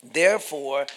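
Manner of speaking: usual speaking voice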